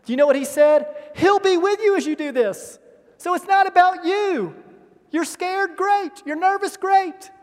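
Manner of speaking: high, loud rallying preaching; preaching at full force. Acted. Pastor rallying scared disciples, loud and high. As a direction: lift them up with every "Great".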